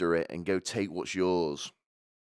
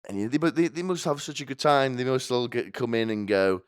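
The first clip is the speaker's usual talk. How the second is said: usual speaking voice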